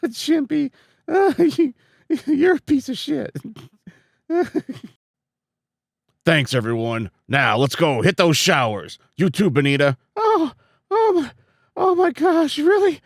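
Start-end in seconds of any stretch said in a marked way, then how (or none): 0.1-3.3 high squeaky character voice; snide high character voice; high cartoon voice. Voicing character insult. 10.1-13.0 put-on high excited girl voice; gushing high character voice; high girly voice. Voicing excited female character.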